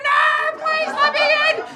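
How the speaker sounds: very high, loud shriek; frantic pleading. Acted. Late passenger begging gate attendant, frantic high shriek. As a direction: screech a desperate plea, hammed up.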